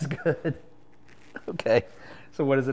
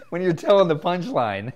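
laughing; 6 semitones higher, 7 dB louder than usual. Real, not acted. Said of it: "your voice shakes".

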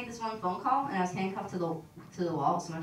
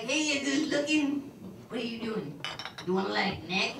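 put-on drunk pickup line. Drunk guy hitting on her.

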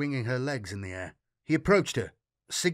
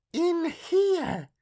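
put-on high and firm child voice; insisting little girl; 3 dB louder than usual. A narrator's reading. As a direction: press the point like a stubborn child. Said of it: "she insisted".

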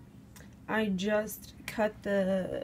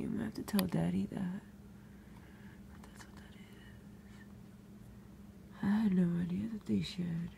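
whisper; 10 dB quieter, faster than usual. Real, not acted. Whispering about the frog shedding.

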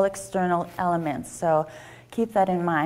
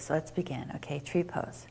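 calm, quieter guided yoga cue; soft yoga guidance; 6 dB quieter than usual. Acted. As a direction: ease the class gently into the pose. Guiding tree pose breathing.

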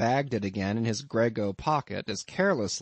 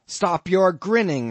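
loud, high, slow angry order; angry shout; 7 semitones higher, 8 dB louder than usual. A narrator's reading. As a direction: snap at him furiously. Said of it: "shouted I".

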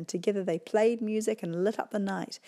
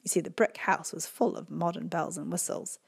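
ordinary delivery

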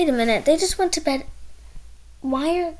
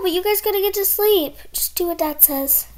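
usual speaking voice

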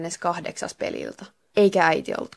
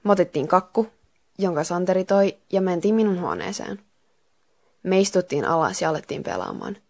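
ordinary delivery

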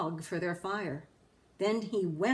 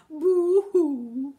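loud, high hammed-up crying; mock boo-hoo wail; 7 semitones higher, 10 dB louder than usual. A narrator's reading. Grinch mocks the crying Whos, loud hammed-up boo-hoo. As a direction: jeer a fake sob. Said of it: "will all cry".

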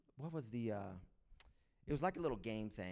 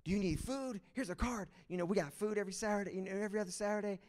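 a higher, fast, eager pitch from a church volunteer; eager evangelist; 7 semitones higher, faster than usual. Retold or imitated. Church ladies offering food to families, eager and fast. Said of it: "they're like evangelists".

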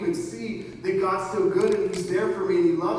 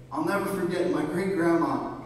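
ordinary talk, nothing marked

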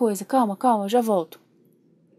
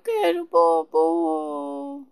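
put-on whining baby; 2 semitones higher, much slower than usual. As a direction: play a fussy baby crying for what it wants. Pretend baby whining for something. (bebê manhoso chorando)

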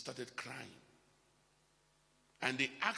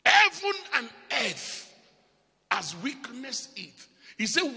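much higher, loud preaching shout; shouting climax; 8 semitones higher, 12 dB louder than usual. Acted. Preacher hammering home his point to the church, shouting. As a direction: drive it home at full force.

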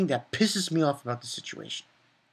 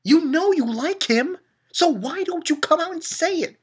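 exasperated challenge; 3 dB louder than usual. Retold or imitated. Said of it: "I'm like".

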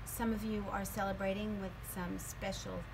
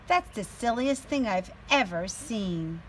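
lively, louder storybook voice; teacher reading a picture book aloud to her class; reading to children; 9 dB louder than usual. A narrator's reading. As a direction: read it out with energy for little kids.

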